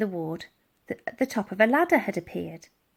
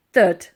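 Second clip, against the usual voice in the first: loud thud; 9 dB louder than usual. A narrator's reading. Door being rammed.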